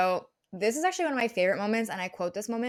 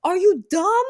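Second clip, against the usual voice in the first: shrill loud yell; 12 semitones higher, 10 dB louder, slower than usual. Retold or imitated. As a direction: scream it at them. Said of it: "Angelina starts yelling".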